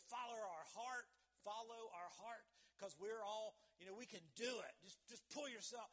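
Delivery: loud, punchy mock motivational hype; hammed-up psych-yourself-up voice; mock pep talk. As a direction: imitate a self-help cheerleader. Mimics worldly self-help pep talk.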